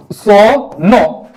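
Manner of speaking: सो नो drawn out very slowly, syllable by syllable; teacher drawing out सो नो; sound spelt out. Shown as a demonstration. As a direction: stretch सो नो slowly to show the sound. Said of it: "टीआईओएन से सो नो".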